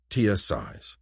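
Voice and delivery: usual speaking voice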